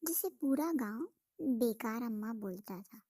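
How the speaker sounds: soft, even storyteller voice; story narration. A narrator's reading. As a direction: tell it like a village tale to listeners. Narrates the village tale.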